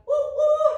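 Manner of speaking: high, loud hooting woo; train whistle. Pretend. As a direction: hoot like a steam train.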